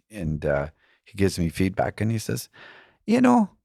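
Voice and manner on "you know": high, lilting put-on Irish brogue of an old man; lightly hammed-up Irish accent, pitched well up; mock Irish accent. Old Irish fella giving feedback, bad Irish accent. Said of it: "I'm not doing a very good, uh, Irish accent".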